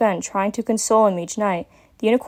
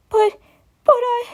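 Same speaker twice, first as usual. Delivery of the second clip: high, sobbing broken start; tearful little dragon; crying; 3 dB louder than usual. A narrator's reading. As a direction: cry and stammer.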